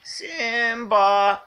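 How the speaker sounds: calling the cat. Real, not acted.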